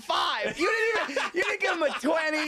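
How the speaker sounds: mock outraged yelling. Teasing his cohost about the tip, mock outraged shout. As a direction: pitch jumps way up in a half-joking shout.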